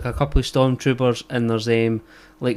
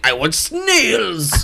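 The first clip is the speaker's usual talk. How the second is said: very slow, higher, hammed-up French accent; mock French accent; 7 semitones higher, much slower than usual. Frenchman ordering in Scotland, mock French accent. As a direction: stretch it out like a cartoon Frenchman. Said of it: "a Frenchman comes over here, he's like".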